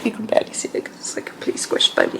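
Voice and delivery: quick hushed whisper. Real, not acted. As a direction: hush it right down. Filming close beside the baby.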